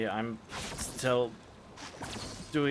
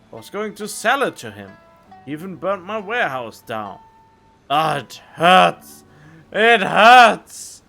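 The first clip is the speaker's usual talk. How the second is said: wounded man's outburst; hammed-up wounded letter writer; 6 semitones higher, 13 dB louder than usual. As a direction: act out a wounded man, building to loud cries of pain.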